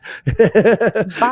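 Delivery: a sudden, high, real laugh; high burst of laughter. Real, not acted. After a dark joke to the host, high laugh.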